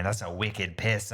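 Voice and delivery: "wicked piss" in a light put-on Boston accent; mock Boston accent. Telling his wife Boston jokes, mock Boston accent. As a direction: joke Boston, "piss" said plainly with an s ending. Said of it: "I was making Boston jokes".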